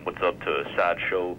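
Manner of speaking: ordinary delivery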